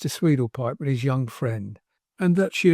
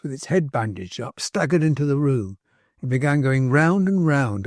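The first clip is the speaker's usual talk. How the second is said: ordinary delivery, nothing marked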